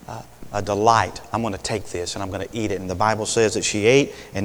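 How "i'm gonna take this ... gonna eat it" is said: soft, fast, to herself; quiet inner voice. Acted. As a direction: mutter the resolve under breath. Eve's thought voiced by preacher.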